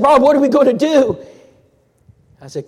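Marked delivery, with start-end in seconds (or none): 0.0-1.2 high, loud, urgent and upset; panicked plea for help; distressed friend. Retold or imitated. Clifton on the phone begging Rob to come, high and urgent.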